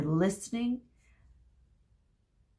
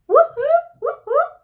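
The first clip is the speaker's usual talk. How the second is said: loud high whooping; huge cheer; 12 dB louder than usual. A narrator's reading. Group B drowning out the groans, shrill whoops. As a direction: whoop like a winning crowd. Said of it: "Group B's huge cheer".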